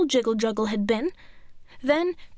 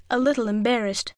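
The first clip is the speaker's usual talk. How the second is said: ordinary delivery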